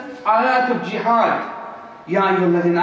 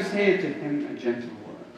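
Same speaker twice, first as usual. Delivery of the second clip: dropped way down and hushed; quiet, lowered voice as if modelling gentleness; soft and low; 9 semitones lower, 8 dB quieter than usual. Shown as a demonstration. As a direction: murmur the command gently. Repeats the command softly.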